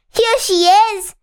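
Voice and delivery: high, loud tiny voice; Baby Bear at his loudest. A narrator's reading. Baby Bear spots the girl in his bed, loud little cry. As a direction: call out as loud as a little voice can. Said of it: "as loud as he could".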